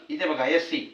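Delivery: ordinary delivery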